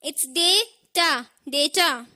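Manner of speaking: data slowly split "day - taa", then whole; correct pronunciation. Shown as a demonstration. Teacher asking learners to repeat, data as "day-taa". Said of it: "please repeat after me".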